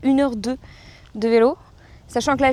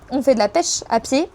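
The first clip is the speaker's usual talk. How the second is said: ordinary delivery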